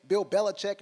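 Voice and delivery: ordinary delivery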